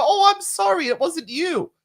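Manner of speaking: high, fussy mock apology; imitated over-apologiser. Apologising to whoever bumped into you, mock over-apologetic. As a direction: mimic someone apologising needlessly. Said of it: "knock into you and you go".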